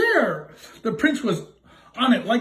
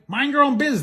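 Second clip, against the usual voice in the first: snippy reply; 7 semitones higher than usual. Acted. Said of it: "the prince replied".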